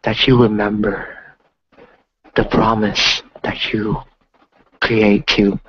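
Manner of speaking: slow, calm meditation voice; guided meditation. Acted. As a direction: guide them gently through the breath. Guided ocean visualization.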